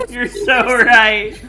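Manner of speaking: usual speaking voice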